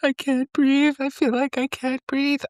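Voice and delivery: high, teary crying voice; crying quote. Retold or imitated. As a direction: voice Buffy breathless with tears. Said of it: "she's crying so hard".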